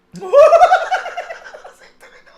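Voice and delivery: howling laugh; loud laughing fit. Real, not acted. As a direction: lose it completely.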